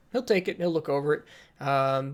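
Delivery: usual speaking voice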